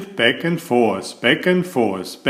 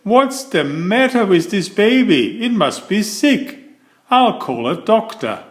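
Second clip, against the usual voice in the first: puzzled principal; 8 semitones higher than usual. A narrator's reading. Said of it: "The principal said".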